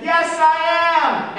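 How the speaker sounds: yelling. Acted. Jonah yelling to the captain over the storm. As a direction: shout it across the deck.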